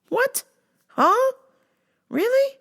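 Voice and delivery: very high, incredulous squeaks; high disbelief. Real, not acted. Questioning the coworker, very high incredulous. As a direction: shoot up in total disbelief.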